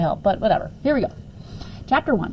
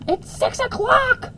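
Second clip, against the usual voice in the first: much higher, drawn-out wail; tearful shriek. Acted. Woken wife wailing at the early hour. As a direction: wail it into the mattress. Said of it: "shrieked a tearful Missus Barraclough".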